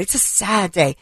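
soft, sighing mock sorrow; mock solemn pundit. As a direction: parody the somber anchors. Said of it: "Greg Stocker put that montage together".

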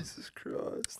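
whispered "Jesus Christ", slow; whispered exasperation. Real, not acted. Reacting to friend's rant, whispered exasperation. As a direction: breathe it out in disbelief.

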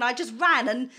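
usual speaking voice

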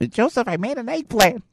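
high-pitched mother voice; high, put-on mom reproach; nagging mother. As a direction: squeal it as an aggrieved mom. Acting his mother's complaint.

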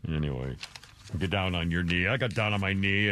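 mock proposal. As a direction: drone it like a bored groom. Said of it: "mocking Gary proposing, low voice".

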